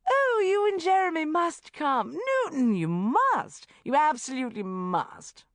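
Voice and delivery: high, loud society gush. A narrator's reading. Rebecca gushing invitation.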